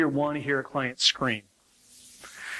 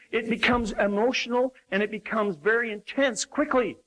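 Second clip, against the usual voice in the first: ordinary talk, nothing marked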